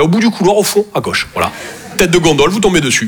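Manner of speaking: ordinary talk, nothing marked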